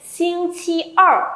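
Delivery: slow, high model of "Xing chi er", lips rounded on "er"; rounded-mouth pronunciation. Shown as a demonstration. Teacher models a new word for students, rounded-mouth pronunciation. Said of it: "Tròn miệng lên".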